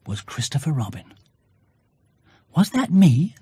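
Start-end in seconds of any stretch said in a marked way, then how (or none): 2.6-3.3 awed. Acted. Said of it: "in an awed voice".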